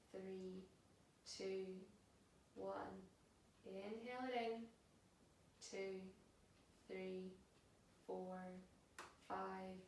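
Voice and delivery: soft, unhurried yoga relaxation voice; guided meditation. Acted. Yoga teacher settling class into a held pose, soft and calm. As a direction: guide them into stillness, hushed and spaced out.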